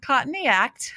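put-on Australian accent. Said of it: "in your best Australian".

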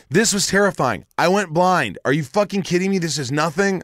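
furious patient telling calm doctors this was no small thing; outraged rant. Retold or imitated. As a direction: vent at the shrugging doctors. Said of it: "I'm like".

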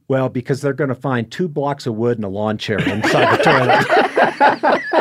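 slow, laughing through the punchline; can't keep a straight face. Real, not acted. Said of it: "laughs telling the punchline".

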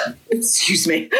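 hiccuping through the words; hiccups. Pretend. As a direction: hiccup mid-phrase. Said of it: "I've got the hiccups there".

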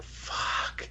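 voiceless, whispered mock dismay; retold muttered curse. Hero reacting to Daredevil sitting beside him, whispered dismay. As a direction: whisper it as the hero's private curse.

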